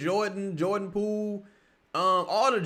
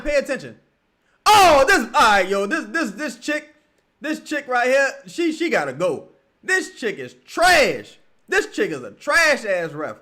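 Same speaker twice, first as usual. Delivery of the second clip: loud, high rant at the ref; real furious yelling; angry shouting; 9 semitones higher, 10 dB louder than usual. Real, not acted. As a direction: tear into the referee. Raging at the referee.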